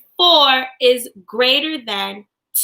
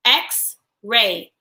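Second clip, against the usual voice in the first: "X-ray" in two separate beats; syllable demo of "X-ray"; broken into syllables. Shown as a demonstration. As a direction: pause between "X" and "ray". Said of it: "Break words in two parts with me".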